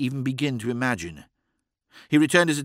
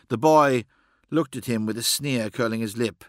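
plain reading voice

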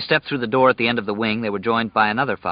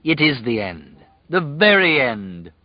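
gloating slow; 4 semitones higher, slower than usual. A narrator's reading. Pirate gloats slowly.

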